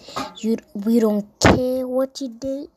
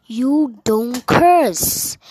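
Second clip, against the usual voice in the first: very slow, weighty stress on curse; slow emphasis; 2 semitones higher, much slower than usual. Real, not acted. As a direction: lay down the rule slowly.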